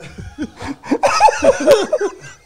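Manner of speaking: loud high cackling laugh; loud high laugh burst. Real, not acted. Guest cackling after cursing the host.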